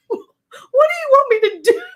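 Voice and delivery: high, loud squeal through laughter; helpless laughing. Retold or imitated. Daughter-in-law laughing on the phone, helpless squealed plea. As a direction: wail it slowly, cracking up. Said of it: "now I'm laughing and she's laughing".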